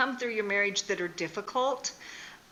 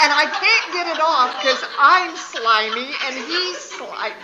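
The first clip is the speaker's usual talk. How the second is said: louder, higher words through laughter; laughing; 6 semitones higher, 11 dB louder than usual. Real, not acted. Speaker laughing as she tells of the slippery rock. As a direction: barely hold it together.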